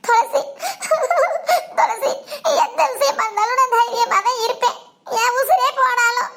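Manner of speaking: sobbing between phrases; crying as she begins to explain; tearful. Acted. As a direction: confide through tears. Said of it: "நீ ஏன் இப்ப கண் கலங்குற?".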